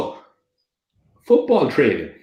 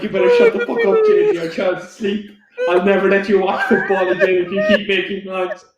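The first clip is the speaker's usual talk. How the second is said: hammed-up wife giving out; mock angry wife; 6 semitones higher than usual. Mocking the wife scolding JJ over noise, fast high telling-off. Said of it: "she's still giving out".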